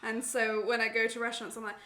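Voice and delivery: ordinary talk, nothing marked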